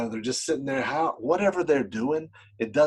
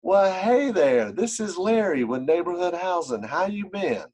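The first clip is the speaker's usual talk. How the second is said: slow, high, easy Southern-paced hello; match a slow Carolina caller by easing way down; unhurried friendly phone greeting in a light Carolina drawl; 6 semitones higher, 4 dB louder than usual. Shown as a demonstration. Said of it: "So you're gonna slow it down".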